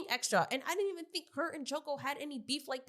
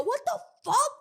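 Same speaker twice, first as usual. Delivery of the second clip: high, loud shocked yell; outraged yell; 6 dB louder than usual. Retold or imitated. Viewer yelling at the studio, high and loud. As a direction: yell it at the screen. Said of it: "yelling at the studio".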